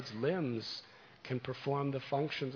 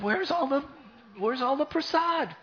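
high, loud startled question; a mother who can't believe the plate is empty; shocked mother; 13 semitones higher, 7 dB louder than usual. Acted. Mother finds the plate empty, high and alarmed.

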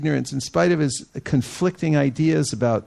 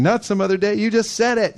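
excited teasing; 7 semitones higher than usual. Retold or imitated. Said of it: "started dancing and saying".

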